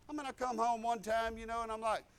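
usual speaking voice

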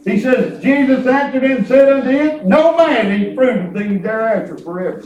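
normal talking voice

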